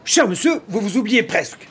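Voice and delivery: high-pitched despairing protest; desperate cry. Acted. Older man protesting to the furious youth, desperate. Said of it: "Cria désespéré le bonhomme".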